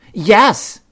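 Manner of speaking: high, loud little bear cub; eager cub. A narrator's reading. Brother Bear starts the whopper for Mama, eager. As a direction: pipe up like a fibbing child.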